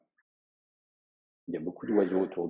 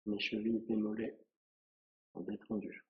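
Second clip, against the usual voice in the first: soft, unhurried meditation voice with long pauses; guided relaxation; 5 dB quieter than usual. Acted. Yoga teacher guiding a student through a relaxing body scan, softly and calmly. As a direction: lead a quiet body scan, phrase by phrase.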